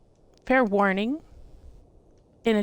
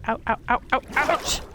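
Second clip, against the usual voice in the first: getting a leg cramp; 5 semitones higher, 4 dB louder than usual. Real, not acted. Sudden pain while talking.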